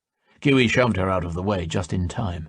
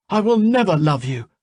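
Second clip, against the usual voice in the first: higher, louder defiant cry; 11 semitones higher, 5 dB louder than usual. A narrator's reading. Giui defying the spirit, crying out. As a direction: throw it back at him. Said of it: "cried Giui".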